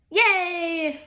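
ordinary delivery

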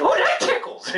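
ticklish squeal. Acted. Ear tickled by whisper.

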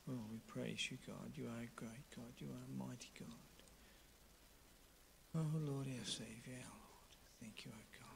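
very low, hushed voice, fading almost to a whisper; murmured private thanks to God; quiet prayer. Real, not acted. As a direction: pray softly under your breath, with long pauses. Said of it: "either quietly or just in a very low voice".

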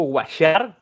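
washar said "wa-shar", English wash plus Spanish ending; slow, rising washar; Spanglish word. Shown as a demonstration. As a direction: Spanglish washar instead of lavar. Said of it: "en lugar de decir lavar".

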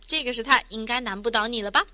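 normal talking voice

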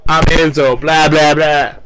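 loud, dismissive blah blah blah; shouted mocking promo; mock hype shout. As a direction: blast it out like a cocky wrestler mocking the crowd.

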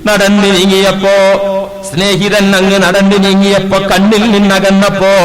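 loud, ringing sermon voice; preaching at full force. Acted. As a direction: proclaim the story to the hall. Loud sermon narration of weeping saint. (ഉച്ചത്തിലുള്ള പ്രസംഗ ശൈലി)

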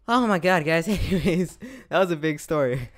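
loud, high, laughing through the words; laughing outburst. Real, not acted. Vlogger to viewers, loud laughing burst. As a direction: crack up at the end of the story.

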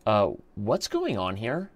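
a hint of a questioning little kid; six-year-old daughter. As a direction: lightly imitate a child, slowly. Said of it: "my six-year-old daughter".

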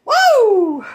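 high, loud happy woo; excited whoop. Real, not acted. Vlogger cheering her viewers, excited whoop. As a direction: whoop it out with joy.

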